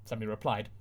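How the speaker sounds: plain reading voice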